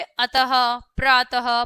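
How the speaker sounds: अतः and प्रातः with a clear breathy ह at the end, slowly; slow visarga examples. Shown as a demonstration. Teacher showing the visarga sound. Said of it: "तो जैसे".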